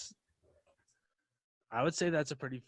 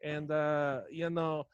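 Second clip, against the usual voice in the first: loud, high hammed-up Russian cult leader welcoming his followers; Russian accent; 5 semitones higher, 7 dB louder than usual. Cult leader greeting the Moscow division, loud hammed-up Russian accent. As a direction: boom out a welcome in a thick fake Russian accent. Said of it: "I'm losing my Russian accent".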